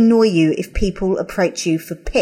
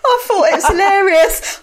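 laughing; 9 semitones higher than usual. Real, not acted. Host laughs telling co-host about the shout-out. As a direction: giggle through the words.

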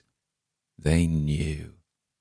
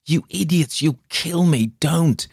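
much higher, panicked plea; frantic cry; 11 semitones higher than usual. A narrator's reading. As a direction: scream it inside your head in terror. Injured man's frantic inner cry.

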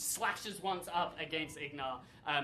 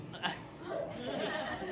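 laughing; 7 semitones higher, 5 dB quieter than usual. Real, not acted. GM laughing at the scene.

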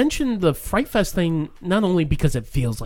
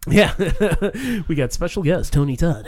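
normal talking voice

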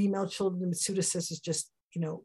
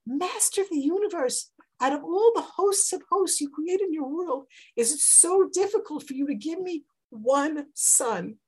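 higher, pleading prayer; higher, louder pleading prayer; Hannah praying; 8 semitones higher, 4 dB louder than usual. Acted. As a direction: beg God for one son.

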